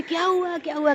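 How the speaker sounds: high, anxious calling out; mock shouting toward the bathroom; retold frightened yell. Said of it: "करके चिल्ला रही हूँ".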